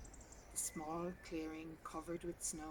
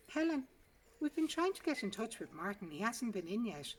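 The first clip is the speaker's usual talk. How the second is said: higher, urgent receptionist cutting in; 5 semitones higher, 5 dB louder than usual. A narrator's reading. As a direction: interrupt her, pitched up. Receptionist cuts in.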